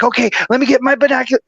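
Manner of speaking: fast and high, fumbling for binoculars; quick flustered mutter to self; rushed self-quote. Retold or imitated. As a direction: hurry it out like the bird's escaping. Retelling own hurried thought.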